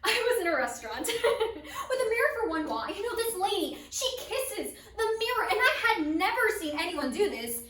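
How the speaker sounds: loud, high-pitched telling broken by big laughs; laughing storyteller. Acted. Performer tells of the lady kissing a mirror, laughing hard. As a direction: crack up while telling it.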